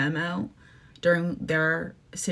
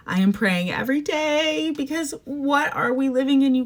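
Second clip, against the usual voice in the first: emphatic; 7 semitones higher, 3 dB louder than usual. Real, not acted. Repeats the line, louder second time.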